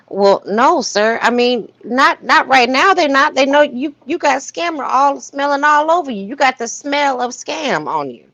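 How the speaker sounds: mock scolding the scammer. Talking straight to the scammer, a sassy mock brush-off. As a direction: tell him off like you're turning him away at the door.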